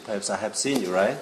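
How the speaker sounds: ordinary talk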